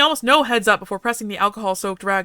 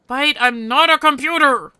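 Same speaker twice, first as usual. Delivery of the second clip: louder, higher, indignant snap; annoyed outburst; 5 semitones higher, 6 dB louder than usual. Acted. To the AI Bite, an indignant louder snap. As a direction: protest that he's no machine. Snapping at the AI.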